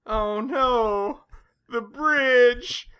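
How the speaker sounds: high-pitched panicked wail; imitating a panicked bystander; distressed cry. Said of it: "the guy filming it was like".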